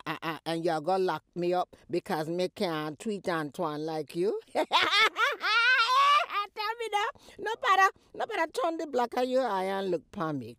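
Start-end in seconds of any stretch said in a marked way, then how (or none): 4.4-6.5 high laughing wail; scornful crying laugh. Acted. 6.6-10.6 scolding. Acted. Mother scolding daughter.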